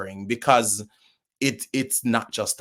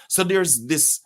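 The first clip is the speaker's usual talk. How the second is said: ordinary delivery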